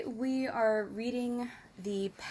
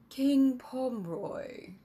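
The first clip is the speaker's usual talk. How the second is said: oily smooth; 3 semitones higher, slower than usual. Acted. Emissary greeting the king, oily and smooth. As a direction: drawl the title like a smug courtier. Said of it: "his voice oily smooth".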